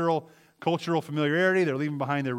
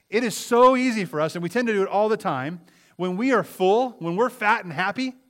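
loud, high impassioned preaching; preaching at full force; 5 semitones higher, 6 dB louder than usual. Acted. Preacher urging the congregation, loud and impassioned. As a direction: lift the room with it.

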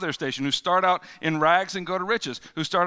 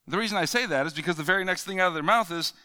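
ordinary talk, nothing marked

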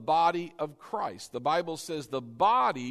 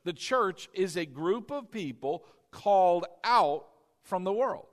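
ordinary talk, nothing marked

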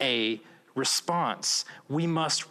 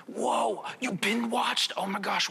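high, excited gasp of delight; mock amazement; 7 semitones higher than usual. Friend hears about the binge watching, mock excited and high. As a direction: play an impressed friend, overdone. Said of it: "But if we were to say".

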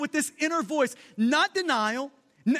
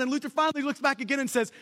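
ordinary delivery

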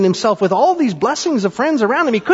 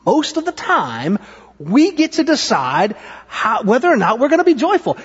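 animated, emphatic. Real, not acted. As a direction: drive the lesson home with energy. Livelier repeat of the lesson.

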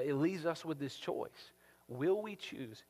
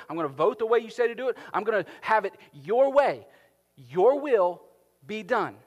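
earnest surrender to God; 6 semitones higher, 7 dB louder than usual. Acted. Believer vows surrender to God, loud and pleading. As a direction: pour it out to God, rising and insistent. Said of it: "you will either say to God".

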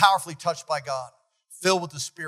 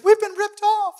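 upset cry; 6 dB louder than usual. Retold or imitated. Upset church members report being cheated.

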